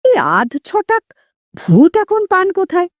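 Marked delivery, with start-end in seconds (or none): none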